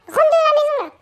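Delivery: loud urgent call. Real, not acted. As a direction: shout the order across the map. Ordering teammate inside.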